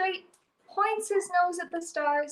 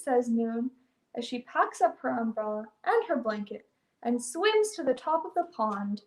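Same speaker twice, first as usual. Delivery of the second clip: ordinary delivery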